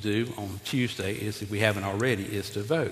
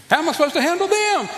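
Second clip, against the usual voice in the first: much higher, louder, hammed-up helpless king; exaggerated Solomon plea; 7 dB louder, faster than usual. Pastor repeats Solomon's line as a joke at the church, hammed up. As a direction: push the plea over the top for a laugh. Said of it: "And he's like".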